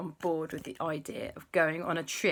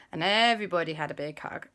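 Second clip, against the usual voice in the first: ordinary delivery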